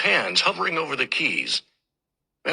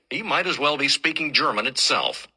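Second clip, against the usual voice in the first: ordinary delivery, nothing marked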